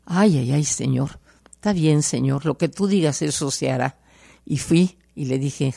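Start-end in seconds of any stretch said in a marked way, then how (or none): none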